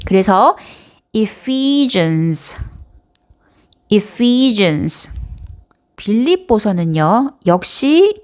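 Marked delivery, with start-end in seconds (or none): 1.1-2.6 Ephesians, slow, with long PHE as "fee"; stress on second syllable. Shown as a demonstration. Teacher modelling for learners, Ephesians stressed on PHE. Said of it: "이음절에 강세가 있어요". 3.9-5.1 Ephesians with PHE stretched as "fee"; stress on second syllable. Shown as a demonstration. Teacher repeating for learners, Ephesians stressed on PHE. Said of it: "이음절에 강세가 있어요".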